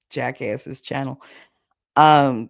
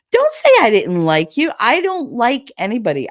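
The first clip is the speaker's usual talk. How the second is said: louder, insistent denial; firm, raised-voice objection; emphatic protest; 7 semitones higher, 7 dB louder than usual. Real, not acted. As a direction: push back hard on the claim. Protesting a chat accusation.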